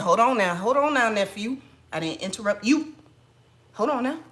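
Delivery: scolding a rude agent as his stern auntie. Retold or imitated. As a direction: check him like a no-nonsense auntie. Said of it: "I had the auntie on him".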